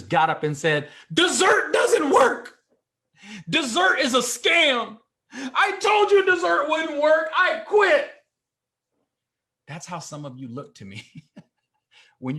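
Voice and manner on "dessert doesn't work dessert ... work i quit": much higher, louder, slow wailing rant of a waiter quitting; a server storming off over a refused dessert, shouting high; mock meltdown yell. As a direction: throw a hammed-up tantrum, dragging every word. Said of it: "What if that waiter or waitress started crying".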